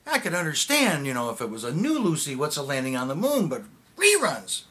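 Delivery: loud exasperation, swooping up in pitch; incredulous rant. Acted. Ranting to an old friend about TV viewers, incredulous.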